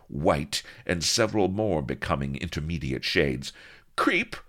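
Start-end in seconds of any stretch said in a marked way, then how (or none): 4.0-4.4 high-pitched outraged yelp; indignant cry. A narrator's reading. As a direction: cry out in offended fury. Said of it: "cried she".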